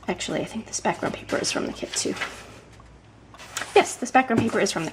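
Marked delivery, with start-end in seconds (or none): 0.1-2.2 half-whispered, much softer, to herself; muttered aside. Real, not acted. Crafter checking her paper, muttering to herself. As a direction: think aloud under your breath.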